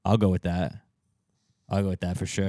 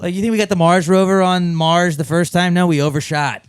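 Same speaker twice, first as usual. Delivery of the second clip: excited; 9 semitones higher, 8 dB louder than usual. Real, not acted. Mock excited argument.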